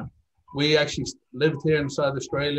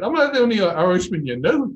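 higher, louder, mock-indignant Irish question; joking surprise; 8 semitones higher, 6 dB louder than usual. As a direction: play it as a teasing protest. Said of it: "I said".

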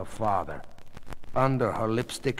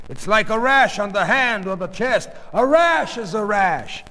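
raised, high, insistent voice; defensive arguing; 10 semitones higher, 6 dB louder than usual. Acted. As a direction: insist loudly that it's nothing. Husband argues about rash.

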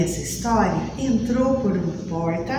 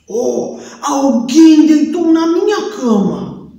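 papa bear; 4 semitones higher, 9 dB louder than usual. Acted. Papai Urso encontra cama.

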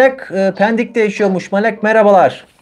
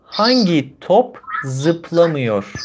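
slow teacher voice; much slower than usual. Shown as a demonstration. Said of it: "teacher slowly asks learner".

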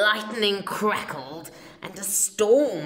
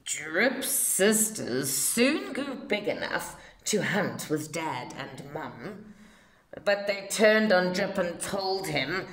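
ordinary delivery, nothing marked

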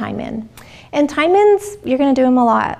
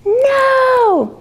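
put-on high loud childlike no; child acting out his scream; kid screaming no; 4 dB louder than usual. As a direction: yell no like an excited toddler. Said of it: "Go for it".

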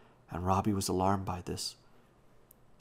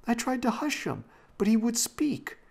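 very high-pitched, loud excuse; protesting; 6 dB louder than usual. A narrator's reading. Robbie excusing himself to Janet, protesting. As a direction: insist it wasn't your fault, pitch high. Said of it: "Robbie protested".

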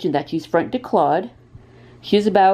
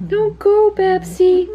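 much higher cooing plea; 12 semitones higher, 3 dB louder, slower than usual. Real, not acted. To the cat Babsy as she walks off, high baby-talk plea. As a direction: coax the cat to stay, half laughing.

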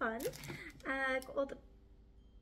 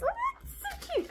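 high cutesy squeal. Real, not acted. Vlogger cooing over her little gingerbread pieces, squealing.

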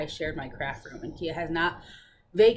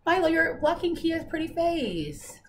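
talking to dog; 8 semitones higher than usual. Real, not acted. Shooing the dog off camera.

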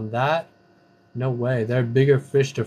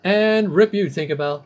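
mock lament; 5 semitones higher, 5 dB louder than usual. Mock mourning a kill.

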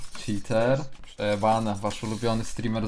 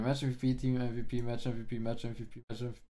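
fading repetition; 8 dB quieter than usual. Real, not acted. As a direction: repeat it, fading to nothing. Repeats, fading away.

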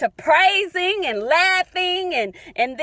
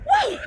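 high whoop of praise; joyful whoop; 12 semitones higher than usual. Real, not acted. Woman praising God in her car, high whoop. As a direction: let out a high shout of joy.

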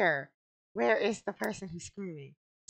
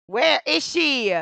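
slow, loud, high wail; frustrated shout; 9 semitones higher, 9 dB louder, slower than usual. Real, not acted. Player unable to find the screaming woman, frustrated drawn-out yell. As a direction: stretch each word in exasperation. Search keeps failing.

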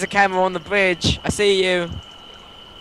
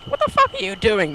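exasperated. Real, not acted. Friend wanders off in game.

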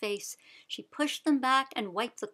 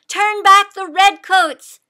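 slow, much louder and higher; rallying cry; 9 semitones higher, 16 dB louder than usual. A narrator's reading. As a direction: call the people to arms. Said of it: "Sybil cried".

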